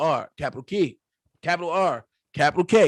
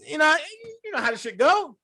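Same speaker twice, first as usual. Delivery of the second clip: ordinary delivery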